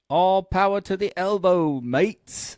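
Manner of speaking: high, loud British cheer; old British accent. Retold or imitated. Egging the workers on in his British way, British accent. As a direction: call it out in hearty British. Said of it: "in my old British way".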